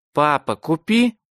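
put-on high, slow pleading child; little girl voice. A narrator's reading. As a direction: beg like a small girl. Little daughter begs father.

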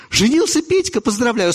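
high surprised echo. Acted. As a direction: raise it in surprise. Visitor echoing in surprise.

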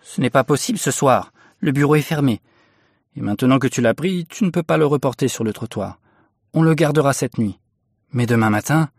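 brusque, begrudging wife; gruff. A narrator's reading. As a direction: give in grumpily, still cross. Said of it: "dit d'un ton bourru".

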